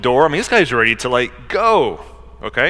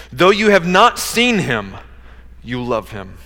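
slow, emphatic verse reading; solemn pulpit reading; scripture reading; 5 semitones higher, 3 dB louder, slower than usual. Acted. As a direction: read each phrase out deliberately, higher-pitched. Reads scripture aloud from pulpit.

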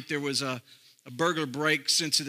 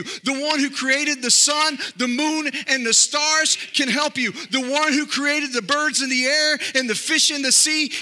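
higher and louder, rousing pulpit cadence; full-force preaching; 8 semitones higher, 5 dB louder than usual. Acted. Preacher rallying the church, full-force preaching. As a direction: build each "He can help you" like a revival preacher.

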